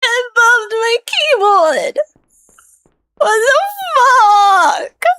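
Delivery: crying lament. Real, not acted. Gamer mourns the ruined run, slow whiny crying. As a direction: drag it out like a comic sob.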